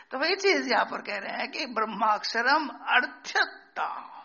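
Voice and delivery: normal talking voice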